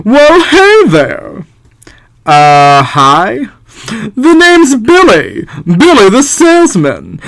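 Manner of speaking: hammed-up Czech salesman voice. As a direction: sell it like a smooth huckster. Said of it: "I really need to work on my Czech voices".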